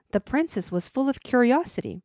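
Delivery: ordinary delivery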